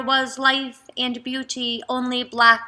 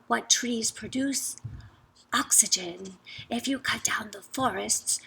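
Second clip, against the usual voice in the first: unvoiced, much softer whisper; 6 dB quieter than usual. A narrator's reading. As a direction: whisper it like a secret. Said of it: "whispered to the man".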